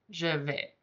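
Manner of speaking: Je vais said with a closed é, soft and low; a teacher softly modelling Je vais; French demo. Shown as a demonstration. Teacher models Je vais for students, softly. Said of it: "I say it more like this".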